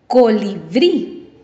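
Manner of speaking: "Colibrí" slowly, with BRÍ punched; stress on last syllable. Shown as a demonstration. Teacher shows students an aguda word, "Colibrí" with BRÍ stressed. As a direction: draw out "Colibrí", leaning on the final BRÍ. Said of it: "Esta lleva la fuerza de voz".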